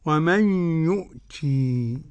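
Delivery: يؤتي ending on a long drawn-out ii; slow recitation, stop on a stretched ii in يؤتي; Ya'qub's pausal reading. Shown as a demonstration. Sheikh shows how Ya'qub pauses, long ii on يؤتي. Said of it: "إذا وقف يعقوب".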